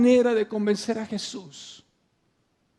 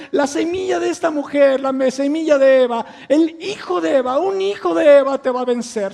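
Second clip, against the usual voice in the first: higher and louder, preaching God's words at full force; booming proclamation; 5 semitones higher, 8 dB louder than usual. Acted. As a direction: proclaim it like a verdict over Satan. God's promise proclaimed to Satan.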